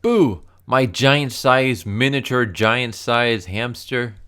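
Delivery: loud, high, overjoyed hero; Minx, all happy. Acted. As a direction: cry out in delight, stretching the words. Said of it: "Minx is all happy".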